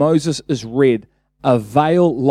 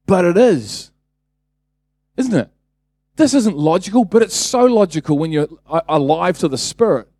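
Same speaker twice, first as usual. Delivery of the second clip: usual speaking voice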